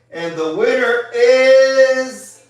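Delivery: slow drawn-out winner reveal, loud; hyped giveaway announcement. Real, not acted. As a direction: build suspense, then belt the name.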